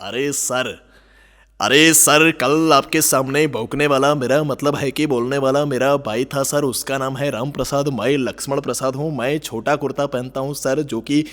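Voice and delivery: mild-mannered man nervously begging a dog; Amol Palekar. Acted. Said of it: "film કલાકાર આમોલ પાલેકર".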